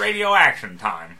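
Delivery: slow, booming radio announcer; old-time broadcaster. Acted. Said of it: "an old-time broadcaster".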